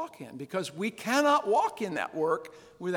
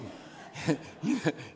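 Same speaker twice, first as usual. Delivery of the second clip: breathy laugh; 3 dB quieter than usual. Real, not acted. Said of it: "'cause it was just funny".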